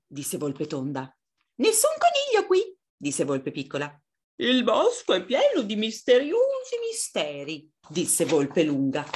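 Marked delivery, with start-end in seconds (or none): 1.6-2.8 very high squeaky little fox; small fox, insistent high voice. Acted. 4.4-7.7 high, swooping fox voice; storybook fox full of mystery; long fox, mysterious. Acted.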